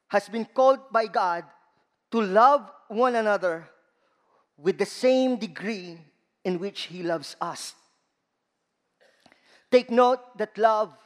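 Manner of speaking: ordinary talk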